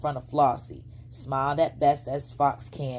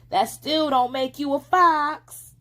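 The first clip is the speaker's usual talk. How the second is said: high, louder, slow taunt; taunting girl; 11 semitones higher, 7 dB louder than usual. A narrator's reading. Flossie teasing the fox as she skips off, loud. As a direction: toss it back at him.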